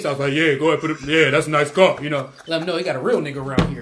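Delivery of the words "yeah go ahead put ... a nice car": lower, firm put-on man's voice; put-on bass. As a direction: drop the pitch to fake some bass. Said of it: "I ain't got no bass".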